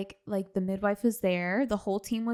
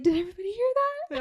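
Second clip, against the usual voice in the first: amazed; 11 semitones higher, much faster than usual. Retold or imitated. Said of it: "I was like".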